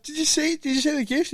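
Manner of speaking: high, excited parent voice asking about the gift; mock parent fishing for a reaction; eager parent. Parent to child behind camcorder, high and eager.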